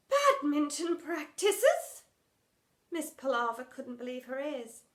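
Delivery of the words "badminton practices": slow, high disbelief; disbelieving. Acted. Drama teacher aghast at the idea, slow. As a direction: repeat it in horror.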